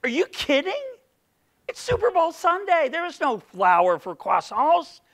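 high, mocking voice of a baker; incredulous baker. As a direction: scoff as the café clerk. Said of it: "and they say".

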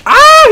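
high, loud startled scream. Real, not acted. Player rushed by an enemy, startled scream. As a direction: scream in sudden alarm.

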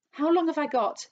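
high, loud, desperate question; anxious cry. A narrator's reading. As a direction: plead to know the worst. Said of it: "cried Missus Twit".

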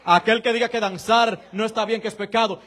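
loud, high, driving sermon climax; preaching at full force. Real, not acted. Pastor exhorting the congregation to dance, loud preaching.

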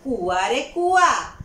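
slow, loud sing-song call; mock-call to the well, drawn out. Farm owner mockingly calls to the well, slow loud call. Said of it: "અને એમણે બૂમ પાડી".

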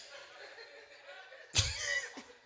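breathless high-pitched laughing. Real, not acted. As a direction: burst out laughing. Big laugh.

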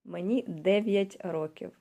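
"Мені дев'ять років" slowly, stress on "дев'ять"; slow teacher demo. Shown as a demonstration. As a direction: model the phrase slowly. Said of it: "You can start to read".

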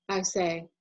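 usual speaking voice

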